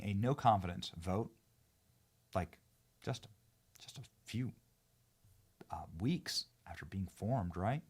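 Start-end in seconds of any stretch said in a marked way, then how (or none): 2.3-7.8 hushed whispered aside. Real, not acted. Host marveling at the government's quick fall, whisper. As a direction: drop to a whisper in disbelief.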